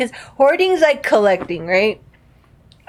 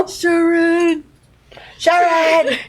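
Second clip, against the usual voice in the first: higher, slurred [name] calling out; [name] impression; 8 semitones higher, much slower than usual. Friend doing [name] calling his wife, higher slurred yell. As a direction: call for Sharon like [name]. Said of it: "I tried to talk like [name]".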